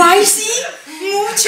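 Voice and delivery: loud, high mock-delighted reply; acted delight. Pregnant woman answering the three heads at the window, acted delight. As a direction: answer with hammed-up glee.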